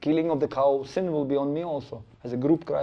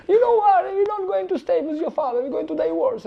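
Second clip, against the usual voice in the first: much higher, loud furious announcement; angry mother; 5 dB louder than usual. Retold or imitated. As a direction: spit out the news of divorce.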